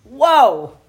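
loud, high burst of shock; startled whoa. Real, not acted. Stunned at her own confession, a loud high whoa. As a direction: gasp it out, stunned.

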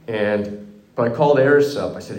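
usual speaking voice

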